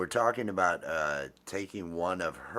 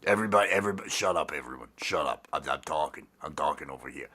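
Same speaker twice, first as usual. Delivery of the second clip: lower, insistent pumpkin character; Frank; 6 semitones lower, faster than usual. Acted. Pumpkin puppet silencing everyone, low insistent character. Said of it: "That could be the pumpkin".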